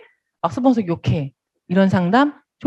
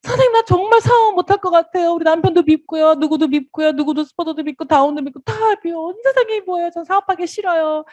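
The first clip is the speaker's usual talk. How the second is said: higher, pleading, sulky whine; whiny complaining member; 8 semitones higher than usual. Retold or imitated. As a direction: moan like a fed-up partner who wants to quit.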